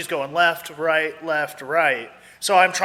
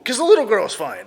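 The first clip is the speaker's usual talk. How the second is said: usual speaking voice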